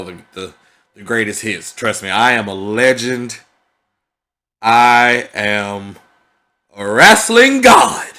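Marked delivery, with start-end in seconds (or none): 1.7-8.1 mock wrestling hype. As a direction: hype yourself like a pro wrestler, climbing to a roar. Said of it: "Let me stop".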